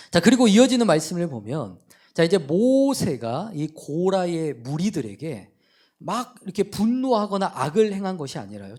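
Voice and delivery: ordinary talk, nothing marked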